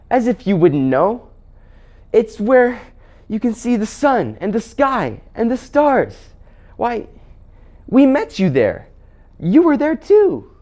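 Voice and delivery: high-pitched, indignant youngster; Scrubb. Acted. Scrubb reminding the Queen we met you there, indignant.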